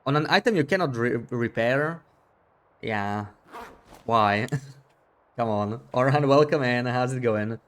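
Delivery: ordinary talk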